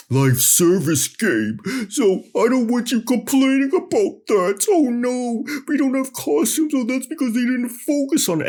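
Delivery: high, loud whiny mock voice climbing into squeaky falsetto; mocked game apologist. Vlogger parroting fans who excuse the missing costumes, mocking high-pitched voice. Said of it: "we're forced to hear".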